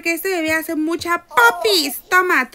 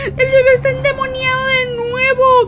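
high, loud frightened shouts; panicked shouting; 8 semitones higher, 6 dB louder, slower than usual. Real, not acted. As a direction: cry out in panic. Bebé endemoniado otra vez. (gritos de pánico)

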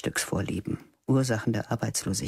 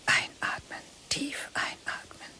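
breathy inner voice; 5 dB quieter than usual. A narrator's reading. Teacher alone at the open window, whispered self-instruction. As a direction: breathe the words out softly to yourself.

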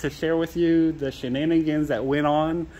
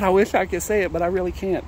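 ordinary talk, nothing marked

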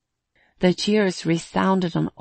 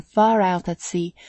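plain reading voice

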